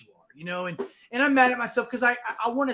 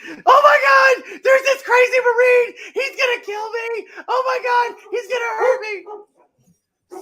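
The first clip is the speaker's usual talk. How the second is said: 拔得很高、带哭腔的夸张尖叫，学得很慢; 把打电话报警的店员学成吓哭的胆小鬼; mock panicked crying; 13 semitones higher, 8 dB louder, slower than usual. Mimicking clerk's panicked 911 call.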